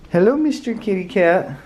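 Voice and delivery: high sing-song greeting to a pet; cooing pet talk. Real, not acted. Vlogger greeting a friend's cat, high cooing pet talk. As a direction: coo it up high, as if to a kitten.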